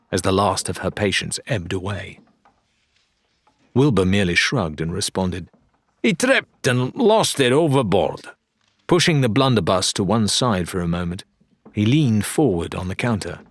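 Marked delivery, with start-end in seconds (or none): 6.0-8.2 light dealer voice. Acted. Dealer answers with a shrug.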